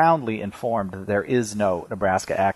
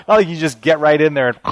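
ordinary delivery

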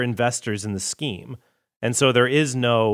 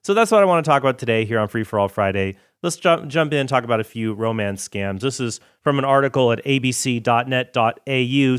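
ordinary talk

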